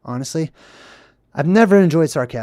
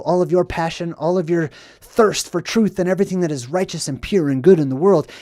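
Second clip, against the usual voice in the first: normal talking voice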